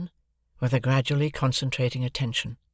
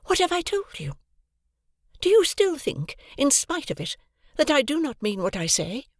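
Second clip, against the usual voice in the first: much higher, mildly sharp; rather angry retort; 13 semitones higher than usual. A narrator's reading. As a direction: snap back with cool irritation. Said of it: "she retorted rather angrily".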